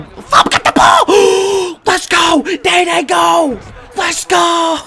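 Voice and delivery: screaming high at the game; high, full-blast celebration; loud excited yelling. Real, not acted. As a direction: yell wildly as the play breaks open. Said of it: "gonna be a little loud".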